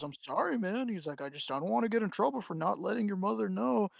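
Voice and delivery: soft, hushed, apologetic clerk; sheepish apology. Retold or imitated. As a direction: apologize under your breath. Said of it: "the guy goes".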